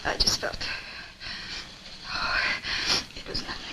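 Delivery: breathless, barely voiced denial; frightened whisper. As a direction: hide the fear in a whisper. Frightened woman hiding her fear.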